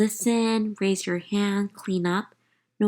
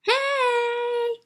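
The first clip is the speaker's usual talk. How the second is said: high, ringing call of a grown-up having fun; a bright high "Hey!" breaking the library hush; gleeful mom cry; 3 dB louder than usual. A narrator's reading. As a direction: yelp it out like a kid at recess. Mom's loud cry in story.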